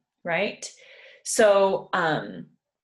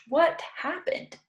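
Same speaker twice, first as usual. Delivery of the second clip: 1 high questioning what happened; 9 semitones higher than usual. Shown as a demonstration. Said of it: "In English".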